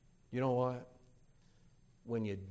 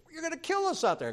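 wife's high protest; faster than usual. Retold or imitated. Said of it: "she goes".

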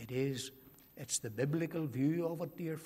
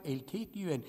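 ordinary talk, nothing marked

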